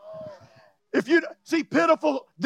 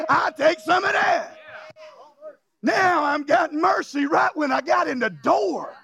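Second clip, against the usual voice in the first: raised, full-force sermon delivery; rousing, high-pitched pulpit declaration; preaching; 6 semitones higher than usual. Real, not acted. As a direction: proclaim it boldly to the congregation.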